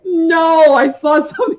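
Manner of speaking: high, loud "No" breaking into a laugh; retold shout. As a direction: yell it, laughing through "something". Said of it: "And I was just like".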